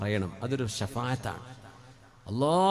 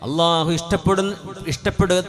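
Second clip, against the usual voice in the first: loud, higher and drawn-out sermon voice; preaching at full force; 4 semitones higher, 11 dB louder, slower than usual. Acted. Preacher to congregation on God's love for a home, loud preaching. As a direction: proclaim it, stretching the words.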